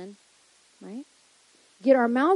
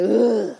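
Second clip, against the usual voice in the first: loud dread-filled ugh; groan of dread; 7 dB louder than usual. Retold or imitated. Preacher groaning at a difficult visitor's arrival. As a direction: groan like your heart just sank.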